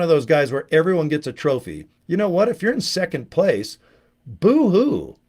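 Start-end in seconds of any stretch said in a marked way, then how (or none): 4.3-5.2 mock crying, pitch up and louder; hammed-up whiny boo-hoo. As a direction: sneer it like a fake sob. Mocking second place losers.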